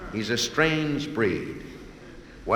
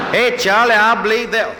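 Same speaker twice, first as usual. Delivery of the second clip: high, loud hammed-up rebel laughing; a yokel soldier cackling his agreement; Southern soldier; 11 semitones higher, 10 dB louder than usual. Confederate soldier joking to his buddy Charlie, loud and high. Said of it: "that's how the South lost the war".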